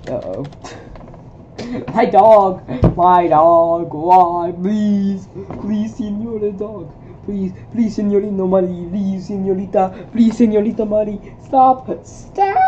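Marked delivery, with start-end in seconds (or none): 1.9-12.6 higher, hammed-up begging; mock dramatic pleading. Real, not acted. Streamer begging his pestering dog, hammed-up plea.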